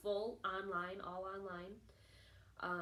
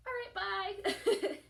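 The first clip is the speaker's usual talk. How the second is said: high, loud, breezy farewell; cheery send-off, pitched way up; gleeful quick goodbye; 12 semitones higher, 9 dB louder, slower than usual. Retold or imitated. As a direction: re-enact happily bolting out the door. Gleeful exit from job, retold.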